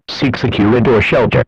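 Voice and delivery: ordinary talk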